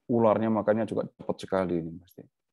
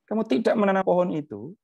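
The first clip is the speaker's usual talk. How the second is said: higher, louder pointed divine reproach; God challenging Jonah, raised and insistent; God rebuking Jonah; 10 semitones higher, 4 dB louder than usual. Acted. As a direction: press the point as God correcting a sulking prophet. Voices God scolding Jonah.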